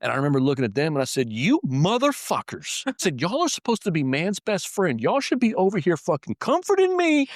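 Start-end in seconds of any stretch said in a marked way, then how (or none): 1.3-7.3 high, loud furious yelling; angry yell at the dogs. Retold or imitated. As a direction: scream at the useless dogs. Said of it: "I was yelling at 'em".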